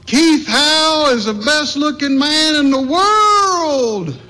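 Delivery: high, slow call across the canyon; hollered. Retold or imitated. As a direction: call it out long and proud. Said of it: "he hollered".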